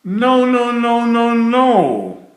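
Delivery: high, loud repeated no's; tantrum. A narrator's reading. Grumpy Jim refusing everyone, loud tantrum. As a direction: stamp out each no like a sulky child.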